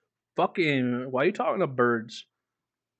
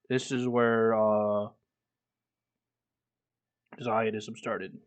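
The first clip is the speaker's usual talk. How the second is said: ordinary talk, nothing marked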